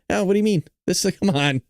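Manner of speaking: usual speaking voice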